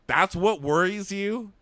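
stunned, can't believe what she heard; incredulous. Retold or imitated. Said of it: "I was like".

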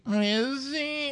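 very high skeptical question; high sarcastic doubt. Pretend.

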